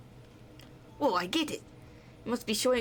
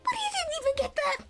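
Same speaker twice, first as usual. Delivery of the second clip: much higher, astonished outbursts; shocked character; 13 semitones higher, 3 dB louder than usual. Acted. Character shocked that the sword has been reformed, high astonished cries. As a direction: gasp it out in disbelief.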